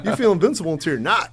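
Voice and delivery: laughing through the punchline. Real, not acted. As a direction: laugh it out, half joking. Laughing repeat of his point.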